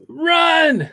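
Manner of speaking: high, urgent warning cry; urgent yell. Acted. Tommy running out huffing, urgent yell to the others.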